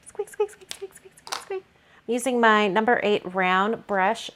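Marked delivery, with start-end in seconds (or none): 0.1-1.6 high, soft mouse squeaks; playful high squeaks copying the marker; squeaky mouse. Pretend. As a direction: squeak along like a tiny mouse. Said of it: "Sounds like a weird mouse".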